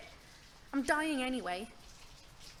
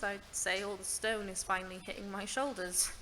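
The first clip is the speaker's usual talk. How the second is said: normal talking voice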